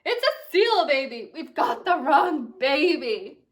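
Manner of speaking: high, loud startled yell; father cries out, slow at the end. Acted. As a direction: shout in shock at the wrong baby. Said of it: "He yelled".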